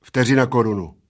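loud, ready answer; fired back. A narrator's reading. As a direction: fire off the stake. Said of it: "vypálil Plešatec".

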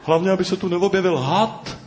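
high nervous worry; worried. A narrator's reading. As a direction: fret anxiously about the snake. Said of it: "strachoval se pták Noha".

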